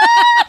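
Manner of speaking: very high, loud delighted shriek; imitated scream of joy; excited squeal. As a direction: shriek like a thrilled winner. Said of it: "He's, like, so excited".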